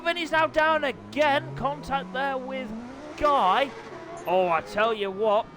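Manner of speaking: lively play-by-play commentator voice; upbeat live bike race commentary. Acted. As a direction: call the race like a TV motorsport commentator.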